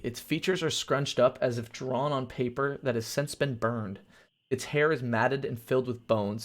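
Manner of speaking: usual speaking voice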